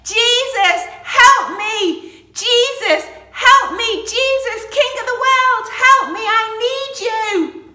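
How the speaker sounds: very loud, high desperate yell; urgent pleading shout. Acted. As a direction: bellow for help at full force. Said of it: "He shouted even louder".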